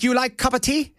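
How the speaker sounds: high-pitched put-on Chinese accent, laughing; mock Chinese accent. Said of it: "'cause her Chinese accent".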